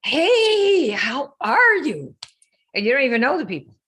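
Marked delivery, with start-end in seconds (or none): 0.0-2.2 much higher, louder, slow sing-song hello; mock sugary greeting to a stranger; drawn-out fake-cheery hello, pitched way up; fake friendly DM. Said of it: "D M everybody with your messages".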